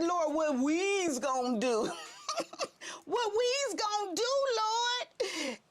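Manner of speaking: high, half-sobbing plea to the Lord; mock tearful prayer. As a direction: wail it like near tears. Crying out in prayer.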